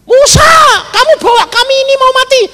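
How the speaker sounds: high, loud, rapid crowd protest; imitated angry yelling. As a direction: yell accusingly at Moses, high and fast. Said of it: "teriaknya sama Musa". (menirukan teriakan panik bangsa Israel)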